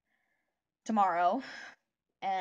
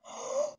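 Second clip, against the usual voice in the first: sharp gasp, then hold the breath. Shown as a demonstration. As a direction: gulp in air and hold it. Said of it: "You hold your breath like this".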